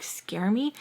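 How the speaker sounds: ordinary talk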